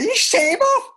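very high squealed outburst; hammed-up falsetto cry; retold exclamation. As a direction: squeal it in mock disbelief, imitating a past reaction. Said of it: "I was saying".

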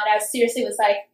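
ordinary delivery

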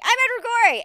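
high, very loud mock declaration; proud child's announcement. Nine-year-old in beard and fur coat, proudly declaring. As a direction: shout it out high like a costumed kid.

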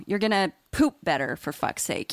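normal talking voice

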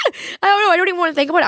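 high, loud burst of overwhelm; flustered outburst. Real, not acted. Podcaster pushing away thoughts of her past self, flustered outburst. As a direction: blurt it out high and loud, settling slightly. Recoils from thinking about it.

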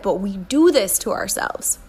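ordinary delivery

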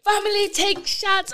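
high, hammed-up island voice urging shots; Caribbean host. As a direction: push the shots like an eager uncle. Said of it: "They was like".

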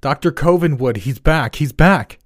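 high, loud panicked call; crying out for help. A narrator's reading. Jack cries out to the doctor for help, panicked. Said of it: "I cried out for help myself".

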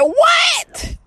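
high shouted what; shocked what. Retold or imitated. Daughter shocked at news of a bird, shouting high. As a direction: yelp it like you can't believe it. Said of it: "She said".

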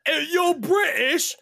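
high, drawn-out cartoon British; mock British accent. Host addressing British listeners, hammed-up British accent. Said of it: "talk to 'em in their language".

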